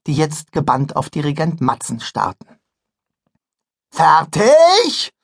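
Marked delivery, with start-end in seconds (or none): 3.9-5.1 loud call. Acted. Conductor calls to band.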